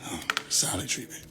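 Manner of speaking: hushed whisper to the chat; whispered aside. Real, not acted. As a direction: whisper it so she can't hear.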